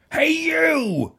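challenging shout. A narrator's reading. Ladybird picks a fight.

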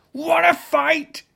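challenging shout. A narrator's reading. Ladybird picks a fight.

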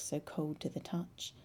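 ordinary delivery

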